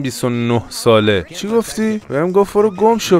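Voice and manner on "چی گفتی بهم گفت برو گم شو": mock outrage. Outraged at NPC insult.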